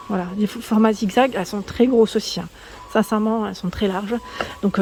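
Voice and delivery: ordinary delivery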